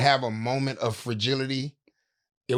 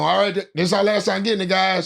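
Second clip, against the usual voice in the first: raised, higher, announcing the last take; director calling out; 6 semitones higher than usual. Retold or imitated. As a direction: call it to the crew.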